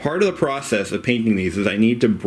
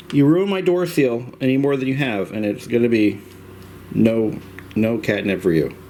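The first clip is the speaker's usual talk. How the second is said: normal talking voice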